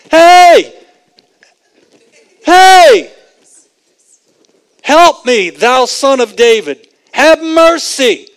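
full-force high, loud yell for attention; desperate screaming. Acted. Blind men trying to get Jesus to notice them, screaming. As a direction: scream for help at the top of your voice. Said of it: "they were screaming as loudly as possible".

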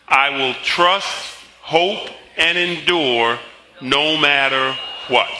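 firm, drawn-out declaration, word by word; slow confession recitation. Acted. Pastor closing the congregation's confession, slow emphatic declaration. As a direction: lead them slowly to the finish.

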